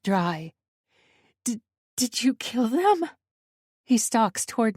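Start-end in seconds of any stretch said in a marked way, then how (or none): 1.4-3.1 higher, fearful stammered question; frightened stammer. A narrator's reading. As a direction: stammer it, dreading the answer. Terrified woman confronts intruder.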